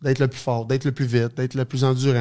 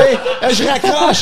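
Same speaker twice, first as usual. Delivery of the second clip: much higher and louder, slow, laughing through it; laughing mock thanks; 13 semitones higher, 9 dB louder than usual. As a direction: brush off the GM with a cheeky laugh. Hangs up on the GM, retold.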